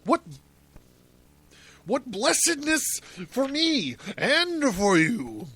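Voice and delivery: high swooning cries of joy; ecstatic. A narrator's reading. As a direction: gush it in a very high voice. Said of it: "Salome, who's still ecstatic".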